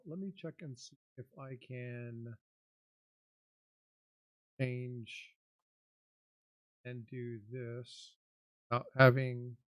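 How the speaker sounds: audio dropping out; fading in. Real, not acted. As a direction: voice cutting in and out, distant. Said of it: "audio fading in and out".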